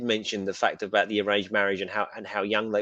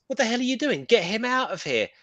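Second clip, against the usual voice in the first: snapping, pitched much higher; mock angry snap; 10 semitones higher than usual. Retelling the scene, a mock angry higher snap at the guards. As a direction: play the officer scolding the guards. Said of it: "she turns around and says".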